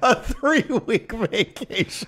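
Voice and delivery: high-pitched, drawn-out incredulity; amazed, voice shooting up through laughter; laughing disbelief. Real, not acted. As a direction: squeal it back in amused disbelief. Incredulous reaction to co-host's vacation excuse.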